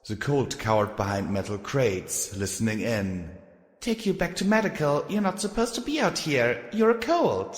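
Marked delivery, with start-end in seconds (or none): 3.8-7.5 high, bossy filly guard voice; bossy young filly. Acted. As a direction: order him back, high and bossy. Filly character voice.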